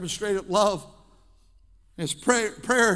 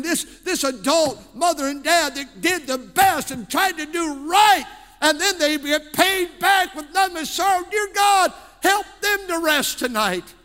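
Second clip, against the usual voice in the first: loud, higher cry building into prayer; full-force preaching; 5 semitones higher, 5 dB louder than usual. Acted. Pastor crying out to God for hurting parents, impassioned. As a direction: shout it out as a fervent intercession.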